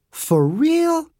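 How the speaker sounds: put-on very high, thrilled child voice; squealing little girl. A narrator's reading. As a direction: squeal it with delight.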